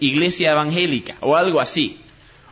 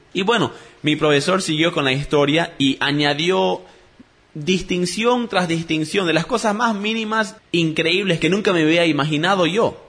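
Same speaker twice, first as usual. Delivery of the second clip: ordinary talk, nothing marked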